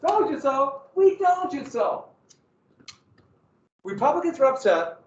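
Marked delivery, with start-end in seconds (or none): 0.0-2.1 high, sing-song told you so; high mocking told you so. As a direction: taunt them like a smug kid. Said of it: "They both said".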